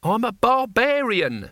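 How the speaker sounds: high, slow defiant cry; restrained character shout. A narrator's reading. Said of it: "shouted Cohen".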